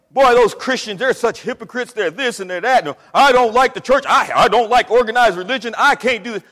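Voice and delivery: loud mock whine of church critics. Mild mock of critics complaining about church. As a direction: sneer through their excuses. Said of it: "They always tell you".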